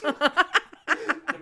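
laughing. Real, not acted. Laughing while speaking.

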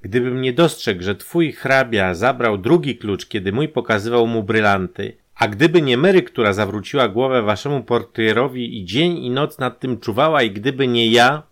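loud, high, building indignation; heated protest. A narrator's reading. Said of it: "gorączkował się Jan".

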